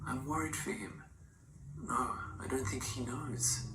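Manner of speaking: a secret murmured in the dark; confiding whisper. A narrator's reading. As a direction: whisper it like a confession to a preacher. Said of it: "speaking low in confidence like someone whispering".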